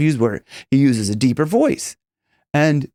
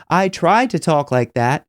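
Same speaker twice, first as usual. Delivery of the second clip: ordinary talk, nothing marked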